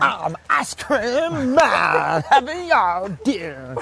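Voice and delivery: hugely drawn-out playful call; too-loud mock announcement. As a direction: belt it out, stretching every word, hammed up. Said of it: "I'm too loud".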